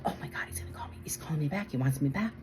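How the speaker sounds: hushed aside to yourself; excited whisper. Retold or imitated. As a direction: whisper it like a secret you can hardly believe. Retold secret thrill at boss calling.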